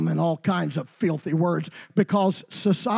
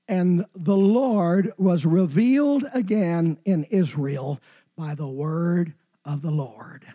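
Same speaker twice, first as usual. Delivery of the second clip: scripture reading; 3 semitones lower than usual. Acted. Reads scripture aloud slowly.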